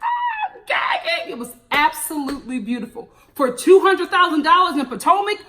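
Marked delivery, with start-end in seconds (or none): 0.0-1.3 very high, slow, crying gasp; overwhelmed squeal. Retold or imitated. Vlogger retelling her reaction when the doors opened, very high crying squeal. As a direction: squeal as if tears are coming. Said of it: "I was crying with her".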